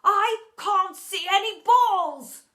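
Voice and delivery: slow, high, loud wail of frustration; frustrated bellow. A narrator's reading. Teacher insists to pupil he sees nothing, frustrated bellow. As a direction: bellow each word slowly.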